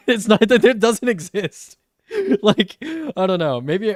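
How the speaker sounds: can't keep a straight face; laughing while talking. Real, not acted. Laughing to chat about Toronto accents.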